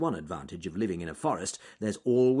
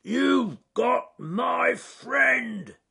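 high, slow furious shout; roaring shout; 14 semitones higher, 6 dB louder, much slower than usual. Acted. Bear shouting at the eagle over his captured friend, roaring. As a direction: shout him down, stretching every word. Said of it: "roared Charles Growly Bear".

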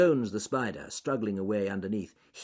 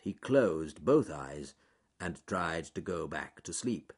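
ordinary delivery

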